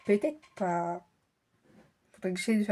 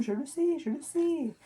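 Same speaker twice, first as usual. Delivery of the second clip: exasperated; 6 semitones higher, slower than usual. Real, not acted. Exasperated reply to nagging.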